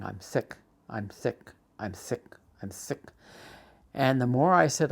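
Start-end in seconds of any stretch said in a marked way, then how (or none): 0.0-3.0 subdued stubborn repeat. Retold or imitated. Speechless with anger, only able to repeat.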